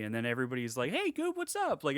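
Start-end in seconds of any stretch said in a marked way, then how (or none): 0.9-1.8 very high put-on falsetto hello; high-pitched, friendly mock greeting; chirpy schoolkid greeting. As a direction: greet a pal in a squeaky cartoon-kid voice. Imitating friendly schoolkids from meme.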